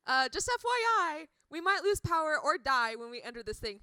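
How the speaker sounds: breezy robot professor voice; chirpy professor. Retold or imitated. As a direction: announce doom like it's good news. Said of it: "he was all like".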